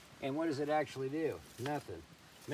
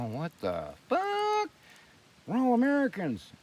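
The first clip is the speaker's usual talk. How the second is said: slow, then a higher, louder shout; mock outrage; 10 semitones higher, 3 dB louder than usual. Mocking people who look back at history, with a loud, high indignant squawk. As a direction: imitate someone indignantly protesting. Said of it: "you'll see enough crap and go".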